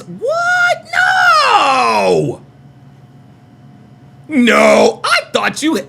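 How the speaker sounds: hammed-up high crying shout; fake-sob in protest; mock wail. Mock wailing at chat.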